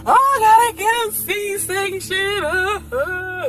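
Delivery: high, sobbing announcement; mock wailing. Patient telling family about C-section, high mock-crying wail. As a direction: burst out bawling, then trail into sobs.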